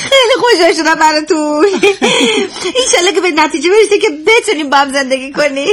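giggly, overjoyed well-wishing; laughing with joy for them; delighted, laughing. Acted. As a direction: congratulate them through happy laughter. Delighted congratulations to the couple.

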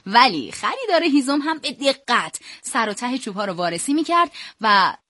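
usual speaking voice

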